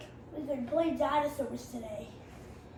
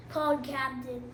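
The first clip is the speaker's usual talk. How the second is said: ordinary delivery, nothing marked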